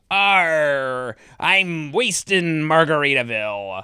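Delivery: imitated slow pirate attempt; drawn-out mock pirate. Host mimicking contestants flubbing the lyric, slow pirate voice. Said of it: "people would use the pirate voice".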